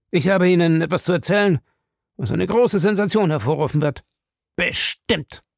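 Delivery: high, insistent, pleased promise; with satisfaction. A narrator's reading. Krieger promises the reporter a sensation, with satisfaction. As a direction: promise it with relish. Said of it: "sagte er mit Befriedigung".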